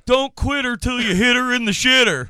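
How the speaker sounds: high, loud yelled chant; mock yell. Sixth game winner yelling his call out, mock yell. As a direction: holler it like a crude bingo winner. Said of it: "the sixth game yells".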